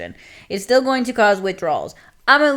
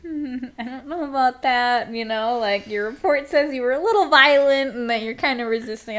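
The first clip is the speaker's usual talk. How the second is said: higher mock-clinician tone; doubtful psychiatrist; 5 semitones higher than usual. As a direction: imitate a psych gently doubting the patient. Psych's voice in retold meeting.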